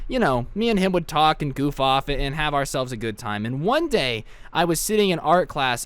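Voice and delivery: usual speaking voice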